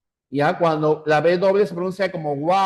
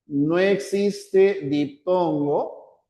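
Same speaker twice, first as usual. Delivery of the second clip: slow emphasis; much slower than usual. Shown as a demonstration. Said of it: "teacher stressing point".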